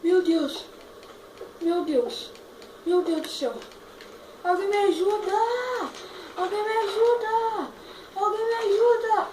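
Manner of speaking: loud high cries for help; desperate yelling for help; panicked shouting. Real, not acted.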